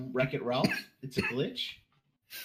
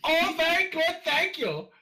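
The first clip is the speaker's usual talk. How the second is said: put-on very high camp German-accented reply; 6 dB louder than usual. Joins the camp German bit.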